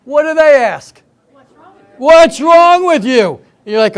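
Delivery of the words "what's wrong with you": loud, high and slow comic exaggeration; hammed-up imitation of a clueless questioner; mock repeated question. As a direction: drag out the question loudly for comic effect. Staff repeat the same question.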